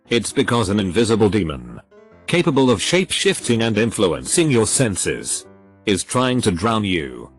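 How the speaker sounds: plain reading voice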